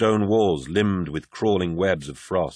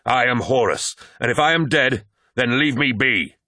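a higher, loud desperate cry; screamed; 4 semitones higher, 3 dB louder than usual. A narrator's reading. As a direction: shout back in defiance and despair. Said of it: "he screamed".

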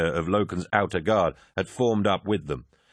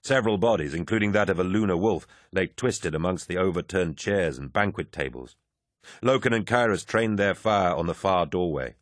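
ordinary delivery, nothing marked

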